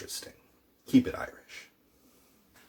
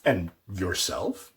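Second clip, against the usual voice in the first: slow, "yourself" set apart and rising; stress on YOURSELF; 3 semitones lower, slower than usual. Shown as a demonstration. Teacher showing what emphatic tusa means, stressing "yourself". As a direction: draw out "yourself" as a pointed question. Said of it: "I'm emphasizing you".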